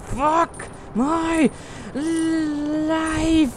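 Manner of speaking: high, drawn-out frustrated wail; exasperated wail. Real, not acted. Gamer stuck in limbo, exasperated wail. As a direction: stretch each word out high in exasperation.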